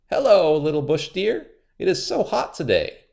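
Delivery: sly spider, high and sweet; a crafty trickster sounding friendly; high, cunning spider; high Anansi voice. A narrator's reading.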